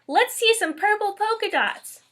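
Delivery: put-on high, loud kid's call; yelled out. A narrator's reading. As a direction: call it out across the class. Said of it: "One yelled out".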